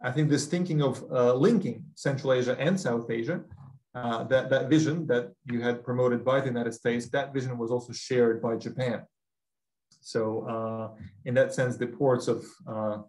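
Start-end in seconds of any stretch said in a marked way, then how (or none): none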